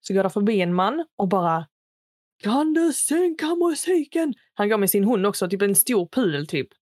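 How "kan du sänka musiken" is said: slow, higher mock neighbour voice; lightly voiced grumpy older man asking to turn it down; a hint of a cranky neighbour; annoyed neighbour. Neighbour complaining about loud music.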